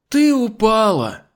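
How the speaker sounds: high, loud, drawn-out cry; dismayed exclamation. Acted. Hostess exclaims at fallen maid. (протяжный высокий возглас)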